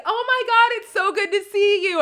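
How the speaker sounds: high, loud, over-the-top excited hello; gushing greeting. Retold or imitated. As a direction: gush like greeting a long-lost friend.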